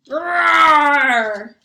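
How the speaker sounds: huge shouted roar; loud bear roar. Acted. Bear roaring back at the old lady.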